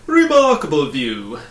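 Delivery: loud proud bug voice; pompous humbug announcing. A narrator's reading. Humbug shows off the view, loud and proud. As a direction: announce it as if you made it. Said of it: "announced the humbug".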